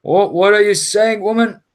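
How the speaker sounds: roused captain. Acted. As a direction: call back irritably from the tent. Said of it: "And then his voice saying".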